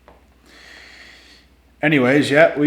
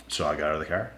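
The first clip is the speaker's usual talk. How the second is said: normal talking voice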